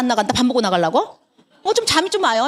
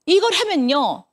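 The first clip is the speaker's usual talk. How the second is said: ordinary delivery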